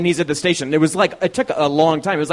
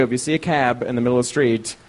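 ordinary talk

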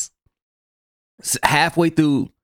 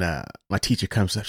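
normal talking voice

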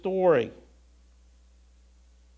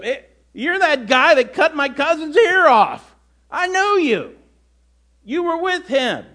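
higher, louder challenge from a bystander; accusing bystander, higher and louder; confronting relative; 9 semitones higher, 7 dB louder than usual. Retold or imitated. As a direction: call him out in a new voice.

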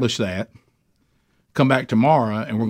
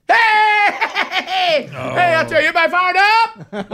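high, booming, laughing hype yell; loud entrance shout; 3 dB louder than usual. Retold or imitated. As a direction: burst in hyping the room. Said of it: "you know how I'm gonna come in".

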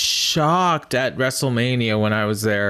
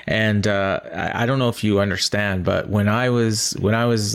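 usual speaking voice

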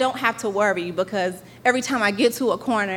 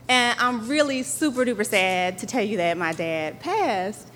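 ordinary talk, nothing marked

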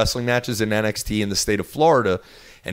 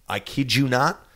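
usual speaking voice

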